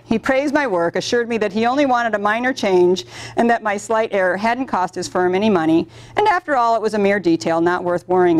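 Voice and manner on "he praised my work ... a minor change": ordinary talk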